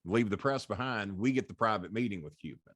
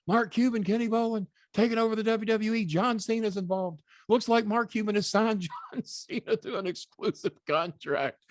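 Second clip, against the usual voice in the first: mock headline; 12 semitones higher than usual. Mock headline the press wrote.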